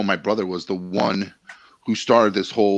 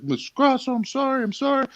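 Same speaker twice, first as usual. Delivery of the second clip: very high, meek apologetic squeak; a scared teacher grovelling to the mom; cowed teacher. Retold or imitated. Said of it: "He's like".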